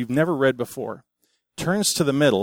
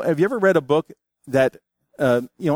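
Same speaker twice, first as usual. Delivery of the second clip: ordinary delivery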